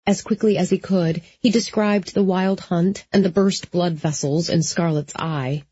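plain reading voice

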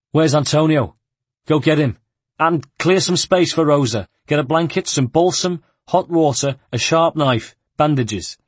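loud, high, urgent commands; urgent orders. Acted. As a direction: bark out orders in a hurry. Ugo barks urgent orders.

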